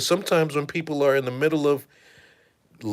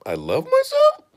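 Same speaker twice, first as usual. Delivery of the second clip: much higher, piping voice with a questioning lift; squeaky doubtful question; 3 dB louder than usual. Retold or imitated. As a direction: squeak it out like a nervous cartoon. Squeaky mirror voice.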